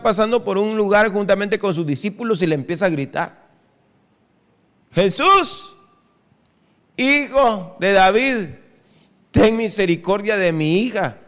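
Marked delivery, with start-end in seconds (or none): 4.9-11.2 pleading cry. Acted. Woman crying out to Jesus.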